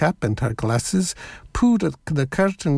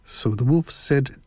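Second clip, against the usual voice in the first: ordinary delivery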